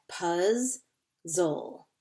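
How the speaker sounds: "puzzle" in two slow pieces, puz, zle; split into syllables. Shown as a demonstration. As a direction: pull "puzzle" apart with a pause after "puz". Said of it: "so we have".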